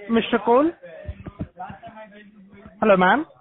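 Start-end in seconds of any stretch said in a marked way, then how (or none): none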